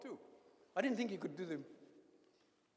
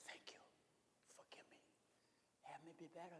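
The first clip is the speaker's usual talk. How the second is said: barely audible, breathed prayer; hushed prayer; 20 dB quieter than usual. Shown as a demonstration. Preacher showing a sighed night prayer, very soft. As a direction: sigh the words out at three in the morning. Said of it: "You can sigh it at night".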